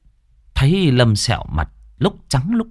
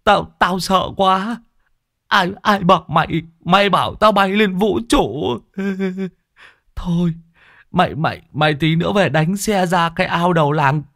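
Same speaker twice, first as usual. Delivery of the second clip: high whimpering excuses; high-pitched, tearful self-defence; sheepish, scared stammer; 8 semitones higher than usual. Acted. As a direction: stammer pleadingly, embarrassed before your friend. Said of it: "ngượng ngùng nhìn Lão Đồng".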